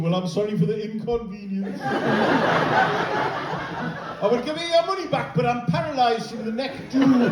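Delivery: high, slow strained apology; apologetic injured driver. Pretend. Bus driver in a neck brace apologising to passengers, high and slow. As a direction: apologise sweetly while stuck in a neck brace.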